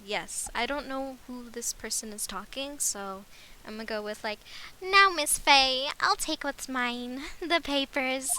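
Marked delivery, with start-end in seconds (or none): 4.8-8.3 slow, put-on villain voice, higher and louder; villain voice for that guy. Villain taunting Miss Fey, in a put-on slow villain voice. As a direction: savor each word like a smug crook. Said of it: "I'ma keep that voice".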